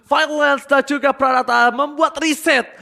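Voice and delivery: loud, hyped-up caster call; fighting game announcer. Acted. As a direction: call the teamfight at full volume. Hype esports play-by-play. (komentator pertandingan yang heboh dan keras)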